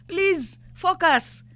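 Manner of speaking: impassioned plea. Real, not acted. Calling on President Ruto in a TV debate, loud slow plea. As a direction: drag it out, begging him to listen.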